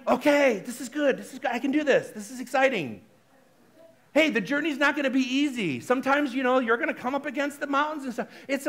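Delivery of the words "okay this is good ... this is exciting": steady, reassuring self-encouragement; composed, upbeat pep talk; calm self-talk. Shown as a demonstration. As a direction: talk yourself through it calmly. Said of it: "Or are you going".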